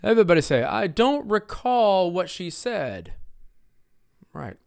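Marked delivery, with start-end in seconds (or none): 0.6-3.1 slow, high model line with re-CALL; second-syllable stress. Shown as a demonstration. Teacher leads the class in repeating, re-CALL. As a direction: hit CALL in "recall". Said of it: "Stressed on the second syllable".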